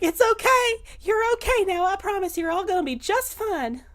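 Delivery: very high, fussing reassurance; mother bear comforting. Acted. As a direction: soothe frightened children in a high, emotional voice. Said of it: "goes into Mother Bear mode".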